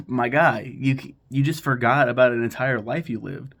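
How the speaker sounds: loud, incredulous viewer outburst; mock disbelief. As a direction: call out the character in loud disbelief. Acts viewer's disbelief at the ending.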